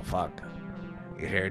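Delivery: usual speaking voice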